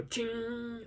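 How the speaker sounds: soft high-pitched ching; imitated chain ching. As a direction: mimic a taut chain ringing. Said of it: "And the, the chains go".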